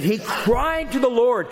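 high, impassioned push on "cried"; emotionally charged CRIED. Shown as a demonstration. Preacher showing the congregation the word's weight, emotionally charged "cried". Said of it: "This is an emotionally charged word".

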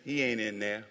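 a laid-back Southern angel. Retold or imitated. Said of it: "I know the angel was Southern".

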